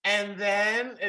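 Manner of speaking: high, loud mock little-kid voice; five-year-old telling a story. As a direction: imitate a small child struggling through a story. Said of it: "you sounded like my five-year-old trying to tell me a story".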